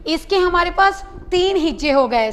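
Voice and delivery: ordinary delivery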